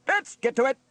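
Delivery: high, loud officer's order; commanding. A narrator's reading. Steele rallies his crew for the alarm, commanding. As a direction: snap the men to action. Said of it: "he commanded".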